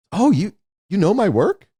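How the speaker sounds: put-on high, wondering old man's voice; a hint of a frail old animator; surprised old man. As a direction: sound touched and amazed. Old Harryhausen voiced in story.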